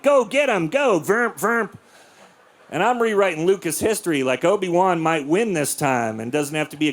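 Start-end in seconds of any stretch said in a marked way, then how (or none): 0.0-1.7 slightly high-pitched play-fight chant; toy-battle cheering with mouthed sword noises; boy playing Star Wars. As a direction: lightly mimic a kid voicing lightsaber battles. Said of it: "I'm in there like".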